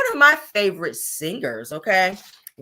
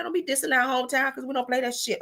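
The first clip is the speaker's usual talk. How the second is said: usual speaking voice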